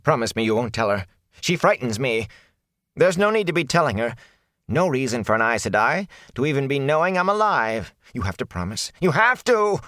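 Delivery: high-pitched anxious begging, rising to a peak; peddler's urgent, fearful plea; frightened pleading. Acted. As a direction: beg desperately, climbing at the end. Peddler begs for secrecy.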